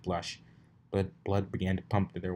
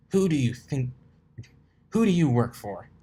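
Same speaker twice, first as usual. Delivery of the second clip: loud, higher hard questioning; interrogating; 6 semitones higher, 7 dB louder than usual. Acted. Dash interrogating the colt, loud demand. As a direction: hammer the question at him.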